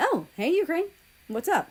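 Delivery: cheerful called reply; calling back. A narrator's reading. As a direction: call back across the hall. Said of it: "she called back".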